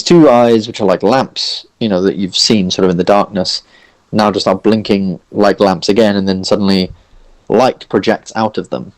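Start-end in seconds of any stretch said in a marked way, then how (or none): none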